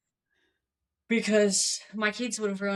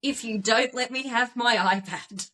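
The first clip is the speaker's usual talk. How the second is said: put-on reading child's note; 2 semitones higher than usual. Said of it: "Let me read you, said note".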